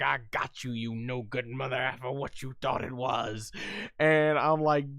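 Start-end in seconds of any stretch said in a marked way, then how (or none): 0.0-3.5 low, quiet taunt; lightly voiced villain. Villain taunting Goku, soft low sneer. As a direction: sneer it under your breath. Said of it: "Glorio's like".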